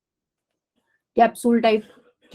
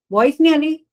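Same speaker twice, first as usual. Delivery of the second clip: louder and higher, pressing the words; repeated louder; 5 semitones higher, 4 dB louder than usual. Real, not acted. Teacher repeats to online students who can't hear, louder. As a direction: say it again so they hear you.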